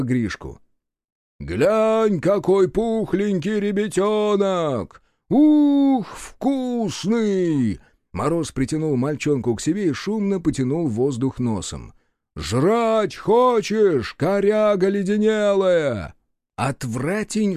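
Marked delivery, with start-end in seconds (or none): 1.4-7.8 slow hammed-up hungry taunt; booming echoing voice. A narrator's reading. Frost luring the monster with child. 12.4-16.1 very slow, high, loud taunt; booming echoing voice. A narrator's reading. Frost taunting the monster.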